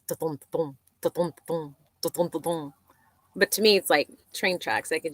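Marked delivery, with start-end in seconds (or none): 0.1-2.7 soft rhythmic da-thump of tires on a bridge; imitated bridge noise. Camping by the bridge, imitating its soft thumping noise. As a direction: mouth the steady thump of the bridge, softly. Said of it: "all night it was like".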